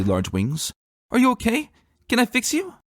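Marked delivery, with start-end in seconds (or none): 1.1-2.7 put-on very high, anxious child voice; a small girl rushing to help; worried little girl. A narrator's reading. Daughter fussing over her injured father, high anxious voice.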